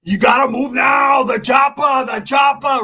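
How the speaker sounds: high, loud, slow mock Arnold Schwarzenegger action shout; Arnold impression. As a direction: bellow an Arnold Schwarzenegger movie line. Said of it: "doing the fucking Arnold".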